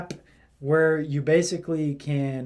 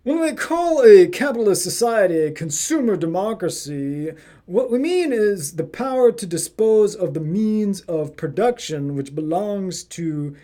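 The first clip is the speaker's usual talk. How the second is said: put-on hoity-toity English accent, higher and louder; 4 semitones higher, 5 dB louder than usual. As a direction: read it like a posh Englishman. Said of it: "I gotta do this in the English accent 'cause it's really hoity-toity".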